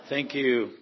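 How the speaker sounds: ordinary talk, nothing marked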